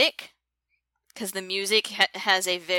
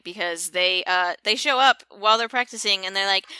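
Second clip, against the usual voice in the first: ordinary delivery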